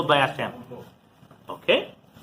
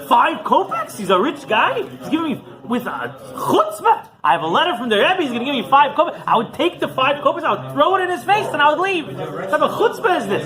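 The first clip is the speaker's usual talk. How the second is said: raised indignant Chassid voice; indignant outburst; 8 semitones higher than usual. Retold or imitated. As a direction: bristle with outrage at the insult. Indignant Chassid ranting about small donation.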